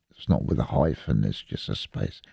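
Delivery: ordinary talk, nothing marked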